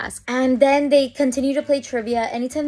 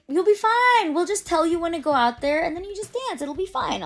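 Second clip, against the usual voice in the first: much higher, breezy reassurance from the stagehand; reassuring staffer; 8 semitones higher than usual. Retold or imitated. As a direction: brush off her worries lightly. Staff reassuring nervous contestant.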